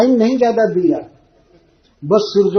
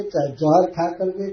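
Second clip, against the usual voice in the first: usual speaking voice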